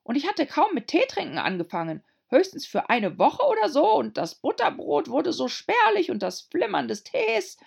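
timid little hatter. A narrator's reading. Hatter babbles nervously.

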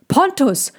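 put-on boy calling the horse. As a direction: call after the horse. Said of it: "rief Theo".

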